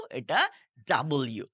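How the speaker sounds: ordinary talk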